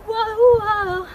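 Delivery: loud high whoa on each jump; calling out. Acted. Dance teacher calling out loudly on the jumps. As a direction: shout it out with the jump.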